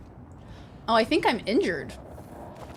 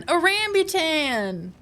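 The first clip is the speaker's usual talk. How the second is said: drawn-out, higher-pitched cry of the word; excited exclamation; 6 semitones higher, slower than usual. Real, not acted. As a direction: stretch it out high with excitement. Excited exclamation over the fruit.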